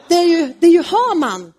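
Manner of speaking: high and loud, naming the culprit; accusing. Acted. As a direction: point the finger at Haman. Esther accuses Haman.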